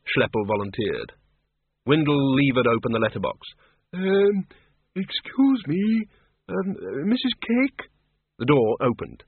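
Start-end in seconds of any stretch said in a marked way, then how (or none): 3.9-7.9 put-on hesitant old wizard. Windle hesitant at letterbox.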